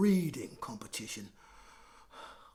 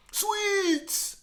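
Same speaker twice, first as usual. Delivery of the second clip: much higher eager sweets; greedy kid; 8 dB louder than usual. Acted. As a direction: drool over the word. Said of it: "said greedy Graham".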